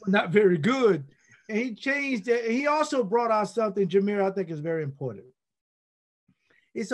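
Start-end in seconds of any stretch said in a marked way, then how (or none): 0.1-1.0 loud raspy imitation of a teammate. Teammate admitting the team is weak, raspy voice. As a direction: rasp it out with volume. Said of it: "in that raspy voice".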